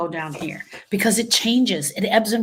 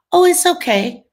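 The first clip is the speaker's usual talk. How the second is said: high, loud, falsely breezy reassurance; role-played brushing-off; 8 semitones higher, 8 dB louder than usual. Griever making the consoler feel comfortable, over-bright acted reassurance. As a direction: wave the sympathy away, pitched up and loud. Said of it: "So I'm gonna role play it".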